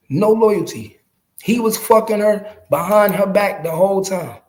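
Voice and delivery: ordinary talk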